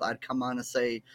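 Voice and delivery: ordinary talk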